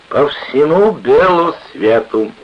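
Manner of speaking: slow, high, loud declaration; expansive drunken boast. A narrator's reading. Drunken sailor boasting of travels, expansive. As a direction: proclaim it grandly to the whole room.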